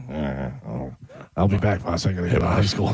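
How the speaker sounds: low, lightly sleazy creep voice. Acted. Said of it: "Tom has creep voice".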